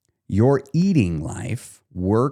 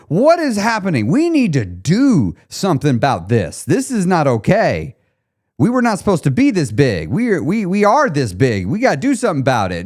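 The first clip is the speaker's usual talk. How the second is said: alarmed executive yelling at the board; panicking CEO; 6 semitones higher than usual. Retold or imitated. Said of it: "the C E O of your life is showing up".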